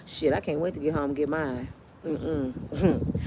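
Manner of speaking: low, soft, muttered aside. Retold or imitated. Married castmate mutters to herself, low and soft. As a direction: mutter it low under your breath.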